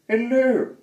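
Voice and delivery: high, loud cheery goblin hello; squeaky goblin greeting; little goblin. Acted. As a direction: call out like a tiny chirpy goblin. Goblin voice in story.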